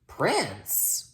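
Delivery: put-on high, creaky deaf old witch; croaking old witch. A narrator's reading. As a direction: croak it like a half-deaf hag. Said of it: "croaked the witch".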